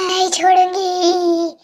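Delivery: a slow, heavy witch voice that relishes the kill; menacing, slow, low witch voice; possessed witch. Acted. Possessed girl vows killing.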